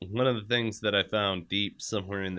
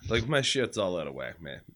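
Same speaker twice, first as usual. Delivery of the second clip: ordinary talk, nothing marked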